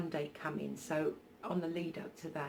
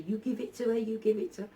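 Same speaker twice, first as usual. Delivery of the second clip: a light Scottish lilt, raised and a bit louder; imitating an elderly Scottish lady, lightly done; old Scottish lady; 4 semitones higher, 7 dB louder, faster than usual. Old Scottish lady urging the brother, light Scottish accent. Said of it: "I can't do a Scottish accent".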